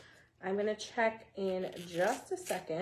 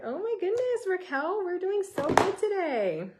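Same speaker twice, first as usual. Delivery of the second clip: excited self-quote; 9 semitones higher, 4 dB louder than usual. Retold or imitated. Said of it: "I was like".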